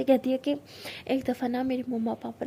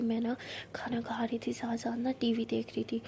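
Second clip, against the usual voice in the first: spooky storytelling whisper; 7 dB quieter than usual. Acted. Rose tells scary story.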